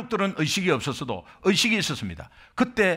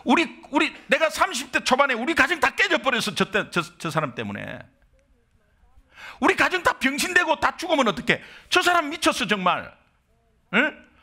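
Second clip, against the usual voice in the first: higher, indignant rant at the other driver; angry blaming; 7 semitones higher than usual. Retold or imitated. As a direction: blame him, outraged and higher. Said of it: "마 원망했어요". (사고 상대를 원망하며 높이 따지는 목소리)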